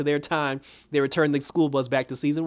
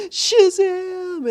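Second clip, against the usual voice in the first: much higher, louder dramatic call; cried out magic word; 7 dB louder than usual. Retold or imitated. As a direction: shout the word like a spell. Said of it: "she was like".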